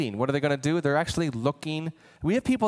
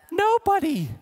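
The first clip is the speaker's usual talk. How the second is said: exclaimed; 12 semitones higher than usual. Real, not acted. Rhetorical answer, exclaimed.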